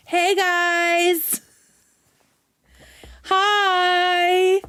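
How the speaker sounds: high, bright mock greeting voice; mock streamer greeting. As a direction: play a chirpy streamer saying hi. Mocking viewers screen recording her.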